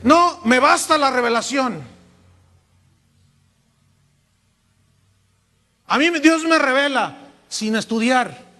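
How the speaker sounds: hammed-up voice of a smug believer; lightly mocking a self-satisfied brother; mocking proud brother.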